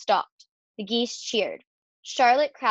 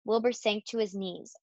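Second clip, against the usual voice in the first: plain reading voice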